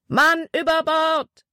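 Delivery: high, drawn-out cry of alarm; alarm shout. A narrator's reading. Deckhand raises the alarm on the steamer, drawn-out shout. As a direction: call it out long and high. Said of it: "wurde auf der War Eagle geschrien".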